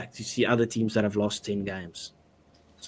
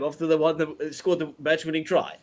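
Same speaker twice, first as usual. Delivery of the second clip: usual speaking voice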